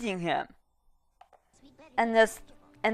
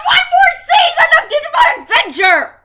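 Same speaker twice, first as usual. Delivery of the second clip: mock despairing wail; 7 dB louder than usual. Despair at one more season.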